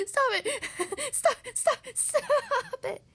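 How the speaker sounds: high, loud pleading through laughter; laughing cry. Acted. Dragon begging Mister Tickle to stop, shrieky laughing cry. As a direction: cry out helplessly between bursts of laughter. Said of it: "he cried".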